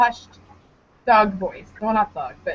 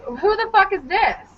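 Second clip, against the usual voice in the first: high-pitched, indignant demand; mock indignant challenge; 7 semitones higher than usual. Caller confronting the woman who answered his phone, mock indignant. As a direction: act out a jealous confrontation.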